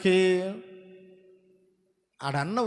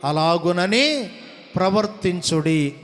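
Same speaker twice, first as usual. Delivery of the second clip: slow, deliberate verse reading; careful scripture reading; slower than usual. Shown as a demonstration. Preacher reading the verse with the congregation, slow careful reading. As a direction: read the verse out in drawn-out, emphatic words. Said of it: "చక్కగా చదువుదాం".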